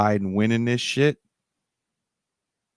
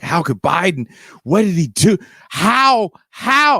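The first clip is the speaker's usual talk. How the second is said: rising, louder, disbelieving How?; upset disbelief; 9 semitones higher, 4 dB louder, slower than usual. Retold or imitated. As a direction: mimic someone freaking out. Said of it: "Katz was getting all upset".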